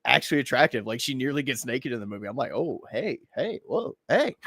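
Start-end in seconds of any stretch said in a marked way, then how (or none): 2.5-4.4 mock flirty interest. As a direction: act pleasantly caught off guard, ogling. Acts interest in attractive actress.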